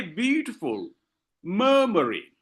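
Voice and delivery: "beautiful" and "murmuring" weighted on the first syllable; dactylic stress. Shown as a demonstration. Teacher showing the dactyl's composition, "beautiful" and "murmuring" stressed at the front. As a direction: hit BEAU in "beautiful", MUR in "murmuring", let the rest fall. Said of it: "when you respect the pronunciation".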